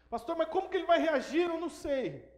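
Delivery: high, loud mock protest; acted-out anxious objection; agitated objector. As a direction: raise pitch and volume as a worried churchgoer. Imitates an anxious objecting listener.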